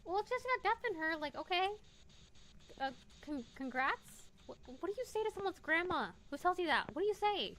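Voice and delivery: high, loud bewilderment; flustered. Real, not acted. Asking what to say to someone's grandma, high and flustered. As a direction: voice jumping up, at a loss. Flustered by spirit box reply.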